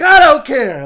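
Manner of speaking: very high, loud mock yell; Bakugo. Cocky hero answering teasing classmates, imitated yell. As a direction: explode in hammed-up defiance. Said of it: "he's just that cocky".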